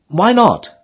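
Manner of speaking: Emily calling. A narrator's reading. Emily asking the rower what he wants, high girlish call. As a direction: ask brightly like a young girl.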